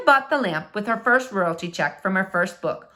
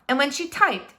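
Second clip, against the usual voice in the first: ordinary delivery, nothing marked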